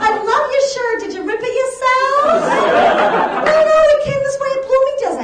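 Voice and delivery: put-on high, gushing valley-girl chatter; Long Island sophomore. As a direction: coo compliments in a high voice. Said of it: "my impression of two Boston University".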